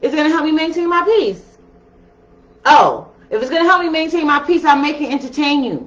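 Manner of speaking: high, quick, sassy mock question. Scorpio weighing someone's drama, quick high sass. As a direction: toss it off fast and high with sass. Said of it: "my Scorpios are like".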